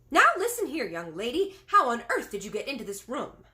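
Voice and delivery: loud, high, scolding tirade; furious shout. A narrator's reading. Maid furiously demands how the girl got in. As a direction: tear into the intruder. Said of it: "the maid shouted furiously".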